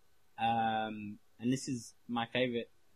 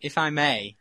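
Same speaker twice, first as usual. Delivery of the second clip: loud, showy taking of the floor; mock-grand interjection; 4 semitones higher, 9 dB louder, slower than usual. Offering to show everyone how it's done, mock-grand. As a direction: cut in loudly like a master showman. Grandly takes over the catchphrase.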